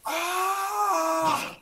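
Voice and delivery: distant man's yelp, faked; mock a scream recorded far away. Shown as a demonstration. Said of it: "let me try to mock it".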